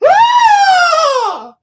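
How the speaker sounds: startled shriek; scream. Retold or imitated. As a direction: cut loose a shrill scream from offscreen. Retold scream in vent shaft.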